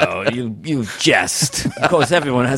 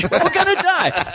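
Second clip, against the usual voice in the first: much higher, whiny sidekick squeal; Walter Brennan. Retold or imitated. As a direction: panic high like an old sidekick. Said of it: "Walter Brennan".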